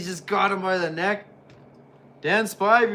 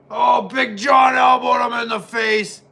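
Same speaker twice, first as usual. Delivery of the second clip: loud, higher play-by-play shout; excited shout; 5 semitones higher, 7 dB louder than usual. Real, not acted. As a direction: yell the hit like a live commentator. Excited play-by-play of a big hit.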